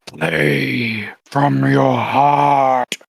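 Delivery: very slow, drawn-out raspy whisperer; soft, deep, raspy voice. Acted. Unseen voice advising the girl at the piano, soft and raspy. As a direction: draw each word out in a rasp. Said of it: "a soft, deep, raspy voice say".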